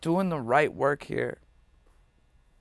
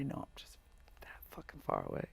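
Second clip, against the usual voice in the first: whisper; 17 dB quieter, much faster than usual. Real, not acted. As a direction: drop to a hushed whisper. Confiding how close success is.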